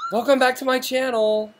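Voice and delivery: high-pitched mock channel welcome; mock co-host impression. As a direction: put on a high voice to tease your co-host. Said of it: "do me".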